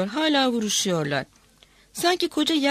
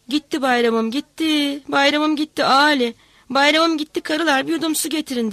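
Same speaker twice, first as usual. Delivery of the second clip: grieving cry; 5 semitones higher, 5 dB louder than usual. A narrator's reading. Irazca wailing to Ali and the women over Bayram, loud lament. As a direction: wail that Bayram is gone. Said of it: "diye bağırdı".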